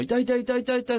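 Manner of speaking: high, quick mock yelping; imitated pained cries. Recalling the patient in pain next bed, high quick imitation. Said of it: "って人がね".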